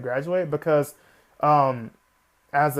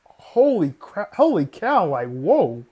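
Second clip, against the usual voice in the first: mock astonishment; 4 dB louder, slower than usual. Imagining Hanji's shock.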